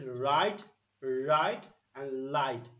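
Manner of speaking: "right" with a pronounced R, then "light" with L; contrasting R and L. Shown as a demonstration. Teacher corrects a mishearing, "right" and "light" carefully separated. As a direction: make "right" unmistakable next to "light". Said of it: "Oh, he's saying light".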